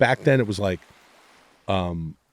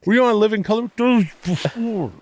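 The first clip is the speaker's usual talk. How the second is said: clueless fan; 9 semitones higher than usual. Pretend. A fan confusing his TV shows, high put-on voice. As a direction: play a fan getting his shows wrong.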